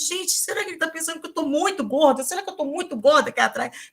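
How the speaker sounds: fast, high, anxious self-questioning; retold panic. Passenger thinking to herself in the back seat, mock panicked. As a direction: act out a mounting inner fret, quick and high. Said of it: "Eu comecei a enlouquecer".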